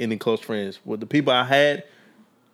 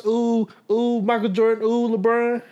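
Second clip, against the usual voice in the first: fangirl voice; 9 semitones higher than usual. Retold or imitated. Mocking fans idolizing celebrities.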